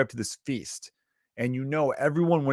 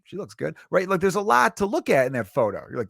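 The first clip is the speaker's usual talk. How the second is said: ordinary talk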